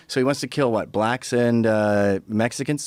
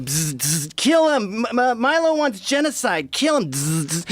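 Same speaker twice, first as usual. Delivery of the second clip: high, buzzing robot chant; malfunctioning robot; 8 semitones higher, slower than usual. Retold or imitated. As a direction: buzz like a broken robot. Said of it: "they're malfunctioning robots".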